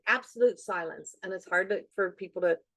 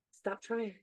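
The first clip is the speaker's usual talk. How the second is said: softly insistent hushed voice; whisper; 9 dB quieter than usual. Retold or imitated. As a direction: imitate the quiet voice from meditation. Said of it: "in the whisper, the voice says to me".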